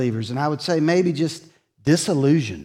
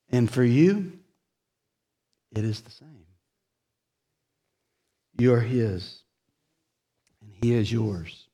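tender assurance; 5 semitones lower, 5 dB quieter than usual. Real, not acted.

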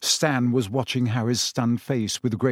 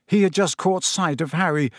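ordinary delivery

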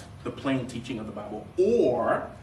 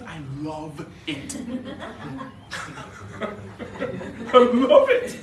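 laughing hard; 7 semitones higher, 4 dB louder, much slower than usual. Real, not acted. Cracking up at himself.

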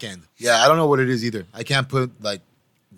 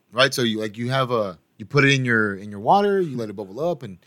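ordinary talk

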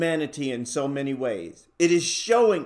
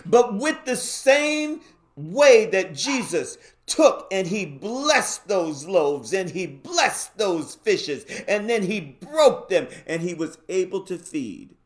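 high, fervent preaching; 5 semitones higher, 4 dB louder than usual. Real, not acted. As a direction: preach it at full force. Preacher builds to full force.

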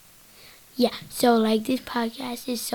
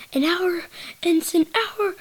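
higher-pitched mock exclamation; exclaimed quote; 7 semitones higher, 3 dB louder than usual. Kid podcaster quoting a reaction to an hour, exclaimed.

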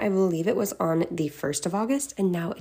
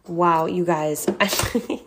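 ordinary talk, nothing marked

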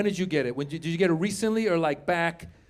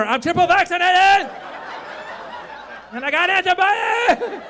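gleeful boast; 11 semitones higher than usual. Retold or imitated. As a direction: crow it triumphantly at the barista. Acts out gleeful boast to barista.

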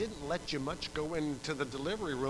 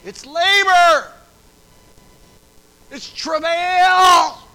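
high, drawn-out preacher's bellow; full-force shout; 20 dB louder, much slower than usual. Real, not acted. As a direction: bellow it slowly to the congregation. Preacher bellows the sermon's key words.

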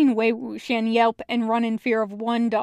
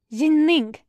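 raised voice, a touch higher; calling out; 5 semitones higher, 5 dB louder than usual. Acted. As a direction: call his name across the field. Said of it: "he calls".

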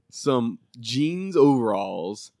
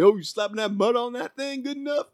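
high-pitched mock heckle; imitated heckling judge; 10 semitones higher than usual. Barbecue judge to a pitmaster, imitated high-pitched heckling. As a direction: heckle like a judge needling the cook. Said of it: "It definitely feels heckling".

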